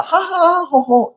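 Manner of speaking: high-pitched fake ha-ha, ho-ho; mock laughter. Shown as a demonstration. Teacher shows students what the poem's smile isn't, mock laughter. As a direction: put on an outward, showy laugh. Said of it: "이렇게 되는 거야?".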